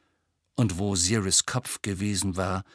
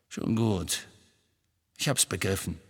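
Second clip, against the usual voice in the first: subdued displeasure, falling away; displeased. A narrator's reading. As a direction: drop it down, curtly annoyed. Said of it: "Er wirkte äußerst ungehalten".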